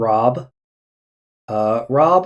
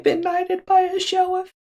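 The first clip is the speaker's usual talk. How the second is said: high excited squeal. Real, not acted. Player gleeful at being knighted.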